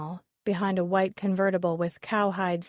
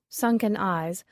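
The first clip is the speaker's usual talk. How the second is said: usual speaking voice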